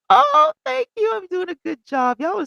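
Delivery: very high, loud thrilled thanks; delighted squeal. Real, not acted. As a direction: squeal with joy at the compliment. Thanking chat for praise.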